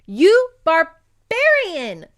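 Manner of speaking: slow, loud high insult; furious yell. Acted. Woman yells at her captor as she throws a root, furious. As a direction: hurl it at him like the parsnip. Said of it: "she yelled".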